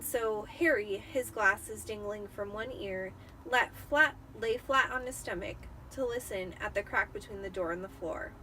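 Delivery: ordinary delivery, nothing marked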